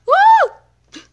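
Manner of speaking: very high, loud celebratory woo; excited squealing whoop. Real, not acted. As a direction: whoop with joy. Celebrating in game.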